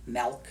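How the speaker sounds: "milk" said melk, with an eh vowel. Shown as a demonstration. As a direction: say milk to rhyme with elk. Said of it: "if you pronounce it milk".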